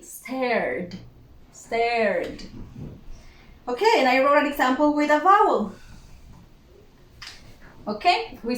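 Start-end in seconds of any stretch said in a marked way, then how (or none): 0.0-2.4 "stared" as one syllable, R then D; careful "stared", no E before the D; pronounced final D. Shown as a demonstration. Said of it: "and pronounce the D".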